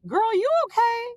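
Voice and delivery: high sassy voice for the cat; squeaky concerned cat voice; the cat checking on her, high and sassy. Pretend. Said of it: "that was the energy".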